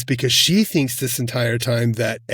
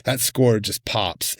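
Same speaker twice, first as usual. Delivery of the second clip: ordinary talk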